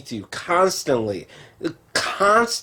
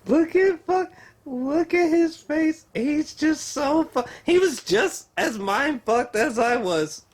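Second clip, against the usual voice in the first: giggly high voice; 11 semitones higher, 3 dB quieter than usual. Real, not acted. Showing actor's face laughing.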